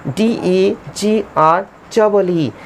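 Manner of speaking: slow, letter-by-letter dictation; measured dictation of each letter; spelling out letters. Shown as a demonstration. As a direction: spell it slowly for the class. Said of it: "degree".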